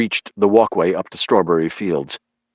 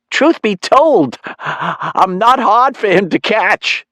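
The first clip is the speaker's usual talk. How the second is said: very high, slow, drawn-out mom voice; teasing mother; 13 semitones higher, 4 dB louder, slower than usual. Acted. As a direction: relish embarrassing your son, pitched way up. Mother teasing her embarrassed son.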